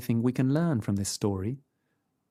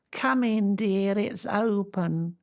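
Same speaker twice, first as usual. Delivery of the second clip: put-on higher, quavery old lady call; old grandmother; 9 semitones higher, slower than usual. A narrator's reading. Grandmother calling to the door, old and frail.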